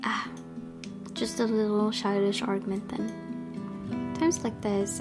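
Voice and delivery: usual speaking voice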